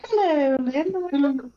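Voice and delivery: high, slow, drawn-out hello; imitated spirit lady. Investigator showing how the spirit lady greeted her, high and slow. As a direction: stretch the greeting out slowly in a high voice. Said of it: "It was just like that".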